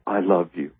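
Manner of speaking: ordinary talk, nothing marked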